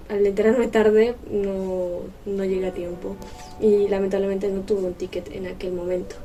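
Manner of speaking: usual speaking voice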